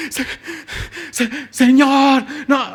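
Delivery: slow, high, halting stammer; stammering in shock. Acted. Disciples frozen with fear before the Lord, stammering. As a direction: stutter out in shock. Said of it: "Estaban en shock".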